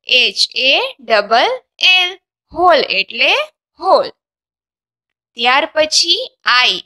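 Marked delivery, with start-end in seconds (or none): 0.1-2.1 letters spelled out very slowly; slow spelling. Shown as a demonstration. Teacher spelling the word slowly for students. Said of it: "spelling slowly".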